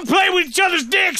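mock scolding parent. Imitating his parents, mock scolding. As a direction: play the shocked parent.

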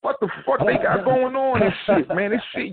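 a retold jolt of disbelief at the news; baffled disbelief. Retelling to the host his shock at [name] rapping, incredulous. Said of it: "I was like".

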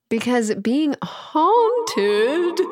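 spooky voice. Pretend. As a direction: stretch it out like a haunting. Halloween intro, haunted word drawn out.

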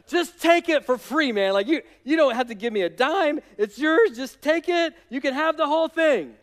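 high-pitched, mock-nervous insistence; hammed-up subject giving it all away; eager placating. Araunah offering his land free to the king, eagerly placating.

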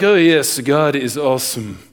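mock-reserved praise, sinking in pitch; frozen, unexcited praise. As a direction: praise with all excitement drained out. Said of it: "we kinda have a frozen chosen problem".

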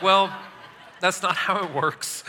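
chuckling through a gentle correction; laughing reply. Retold or imitated. Dad laughing as he corrects his little girl's dragonfly fear. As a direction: laugh it off while setting her straight.

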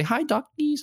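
very high, cooing little greeting; cutesy greeting. Pretend. Greeting imagined ducks at the pond, cutesy and high. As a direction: squeak it sweetly at the little ducks.

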